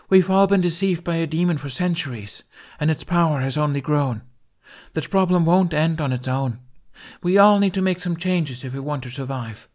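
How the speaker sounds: forceful speech. A narrator's reading. Captain warns the crew of the demon, loud and firm. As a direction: drive the warning home to the crew.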